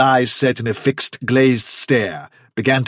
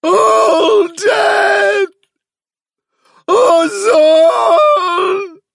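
very high, loud wailing lament; unearthly, desolate shriek; dreadful squealing cry; 10 dB louder, much slower than usual. A narrator's reading. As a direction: squeal it out, drawing each word long. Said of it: "cried the dreadful, squealing voice".

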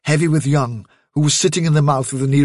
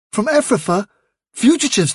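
much higher, incredulous questioning; startled query; 9 semitones higher than usual. Acted. Hazel hearing of rabbits from Ephrafa, startled query. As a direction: echo the news in alarm.

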